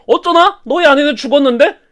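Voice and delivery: villain gloating to the captive; cruel gloating. Acted. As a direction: twist the knife. Sauron gloats over dead wife.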